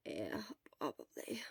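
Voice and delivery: weak sick whisper; barely voiced, exhausted; hoarse whisper. Sick Shura whispering weakly.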